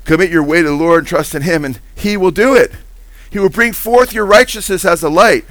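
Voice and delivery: high, loud, driving sermon voice; preaching at full force. Acted. Pastor urging congregation to trust the Lord, loud preaching. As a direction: press each verse home to the congregation.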